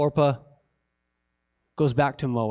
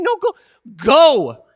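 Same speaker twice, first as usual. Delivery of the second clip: insistent dismissal; 8 semitones higher, 8 dB louder, slower than usual. Acted. Preacher voices Naomi sending them away.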